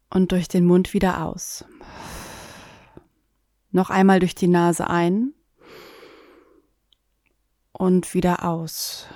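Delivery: calm guided meditation voice; sleep story narrator. Acted. Guided breathing, eyes closed.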